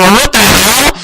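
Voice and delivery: startled what the hell; shocked outburst. Acted. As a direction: blurt it out in disbelief. Character shock, read out.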